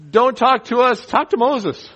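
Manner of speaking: high, loud mock panic; hammed-up fear, pushing Moses forward; frightened people. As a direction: plead like scared Israelites.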